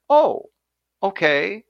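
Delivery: a hint of a giddy inner voice; the brain talking. The brain answers him, a lightly voiced eager inner voice. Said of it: "And what did my brain say? It said".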